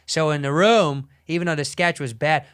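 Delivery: ordinary talk, nothing marked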